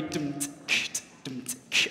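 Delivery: beatbox the pattern with no full words; beatbox rhythm. Shown as a demonstration. Leader gives one team its part, beatboxed rhythm. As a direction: drum hit and hissed cymbal in time. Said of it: "you guys are gonna be".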